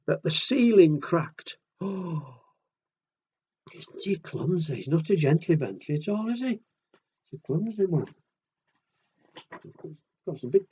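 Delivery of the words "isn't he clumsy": ordinary delivery, nothing marked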